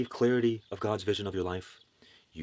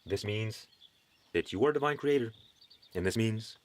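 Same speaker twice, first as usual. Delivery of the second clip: ordinary delivery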